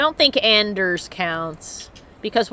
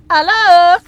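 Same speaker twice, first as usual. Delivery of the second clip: loud high greeting; calling out; 9 semitones higher, 8 dB louder than usual. Real, not acted. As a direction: call out hello brightly. Calling out loudly in game.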